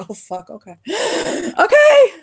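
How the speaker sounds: gasp, then a high loud laughing okay; shaken, laughing recovery. Acted. Lucian recovering after the goddess screamed at him, shaken laugh. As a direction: catch your breath and laugh it off.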